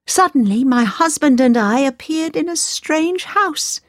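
a princess still unsettled, telling her father; shaken princess. Acted. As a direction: princess recounting a strange night.

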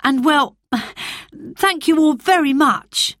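high, earnest schoolboy voice; a boy thanking a crowd, a little shy; a schoolboy giving his first thank-you speech; nervous boy's speech. A narrator's reading.

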